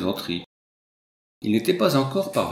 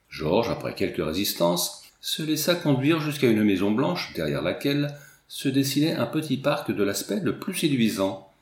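ordinary delivery, nothing marked

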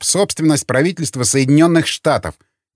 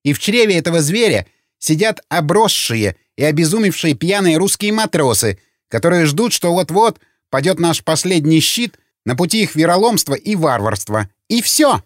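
high-pitched, agitated tirade; heated rant; 7 semitones higher than usual. A narrator's reading. Opponent berates Donald over the lurking nuclear submarine, angry and agitated. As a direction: let the alarm and anger drive the pitch up.

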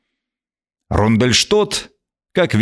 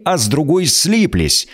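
usual speaking voice

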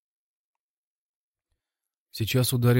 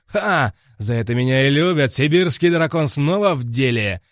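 louder, higher triumphant boast; triumphant gloat; 4 semitones higher, 6 dB louder than usual. A narrator's reading. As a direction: crow over the win. Hero gloats after knockdown.

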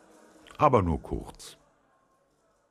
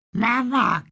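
high, loud chick squawk; 9 dB louder than usual. A narrator's reading. A newly hatched chick croaks at the captain, high squawk. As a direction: squawk it out like a needy baby bird. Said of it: "krächzte es".

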